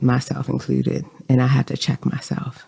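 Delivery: softly whispered admission; whispered aside. Real, not acted. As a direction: let it slip out in a whisper. Confessing aside about herself.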